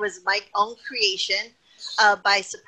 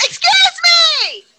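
"Excuse me!" screeched far higher, much louder, slow, stretched; catchphrase shout; 12 dB louder, much slower than usual. Shown as a demonstration. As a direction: belt out "Excuse me!" to silence the arena. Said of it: "I went".

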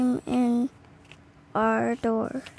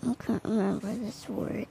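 whisper; 7 dB quieter, faster than usual. Real, not acted. Child whispers aside while reading.

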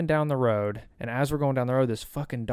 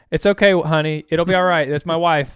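mock soothing wife, louder; hammed-up comforting honey voice; 4 semitones higher, 8 dB louder than usual. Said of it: "Kayla said".

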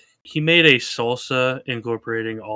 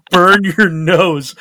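laughing through it; 6 semitones higher, 11 dB louder, much slower than usual. Retold or imitated. Retold laughing at hot salsa.

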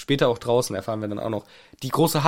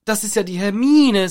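surprised Harry; 7 semitones higher, 5 dB louder than usual. Retold or imitated. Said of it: "sagt der Harry".

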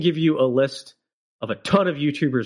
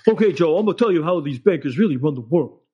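lightly voiced smug pundit; a hint of a know-it-all explaining; louder, slightly hammed-up guru tone; mock expert; 3 semitones higher, 3 dB louder than usual. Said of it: "And it's like".

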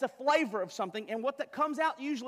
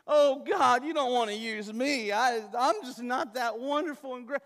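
mock humble Moses; 3 semitones higher, 7 dB louder than usual. Preacher voices Moses' false humility.

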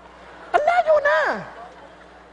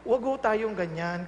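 gentle rebuke; 7 semitones lower, 5 dB quieter, slower than usual. Retold or imitated. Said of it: "sabi ko".

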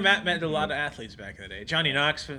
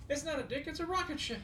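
much higher, softer laughing voice; high mock voice; 11 semitones higher, 11 dB quieter than usual. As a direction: squeak it out like a cartoon. Movie quote.

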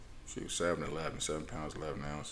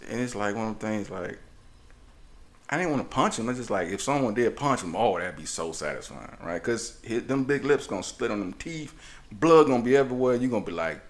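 usual speaking voice

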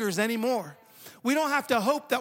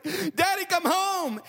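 high-pitched mock panicked plea; imitated terrified daughter; 8 semitones higher, much slower than usual. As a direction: act out a child's frightened phone call. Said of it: "getting a terrified call from my daughter".

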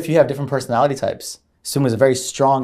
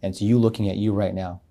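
guided meditation voice; 2 semitones lower, 7 dB quieter than usual. Retold or imitated. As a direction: guide them down the path, softly. Retold guided meditation continues.

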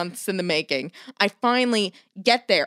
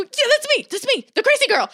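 much higher, bright self-mocking eagerness; excited squeal. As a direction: jump up the pitch, eager to be recognized. Eager to be remembered.

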